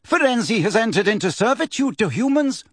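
high, indignant centaur voice; accusing centaur. A narrator's reading. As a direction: make it a haughty accusation.

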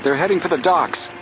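high-pitched excited call; shouted warning. A narrator's reading. Joe to Frank in pursuit of robbers, shouted. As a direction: call out over the chase, not at full volume. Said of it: "Joe shouted".

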